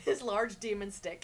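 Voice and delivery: words through laughter; laughing. Real, not acted. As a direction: laugh while saying it. Says it laughing.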